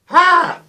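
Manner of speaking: sharp high yelp at a scalding sip; pained yelp. Real, not acted. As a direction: yelp at the sudden heat. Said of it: "Christ, that's hot".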